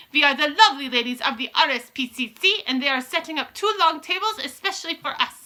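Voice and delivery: high, loud commanding witch voice; Grand High Witch. Acted. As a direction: lay down the rules like a witch queen.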